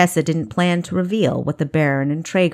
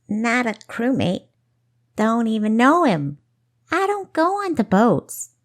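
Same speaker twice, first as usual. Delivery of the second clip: high-pitched put-on Daisy Field voice; Daisy Field accent; 6 semitones higher than usual. A narrator's reading. Disguised girl denying she's crew, Daisy Field accent. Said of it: "imitation of a Daisy Field accent".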